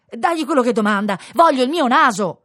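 loud, high demand; impatient order. A narrator's reading. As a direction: insist on having it back. Master impatiently orders his servant. (ordine perentorio a voce alta)

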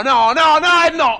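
loud, rising no no no; shouted refusal. Acted. Passenger yelling at the driver, shouted refusal.

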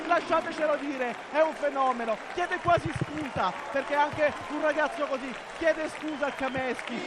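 high, overjoyed victory commentary; breathless Italian goal commentary. Real, not acted. Celebrating gold after the win.

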